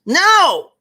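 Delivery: very high, loud yelled no; child's shouted refusal. Acted. Queenie refusing candy floss, a high childish shout. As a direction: explode in a little kid's yell.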